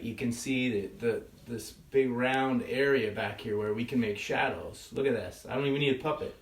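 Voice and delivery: usual speaking voice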